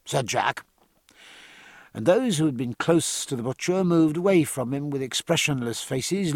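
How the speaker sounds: plain reading voice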